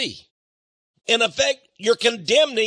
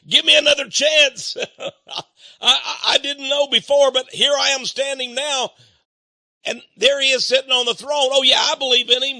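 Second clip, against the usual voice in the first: high, loud, laughing plea for another chance; mock the excuse of someone begging too late; half-amused imitation of a desperate believer; pleading latecomer; 6 semitones higher, 3 dB louder than usual. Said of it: "multitudes who say".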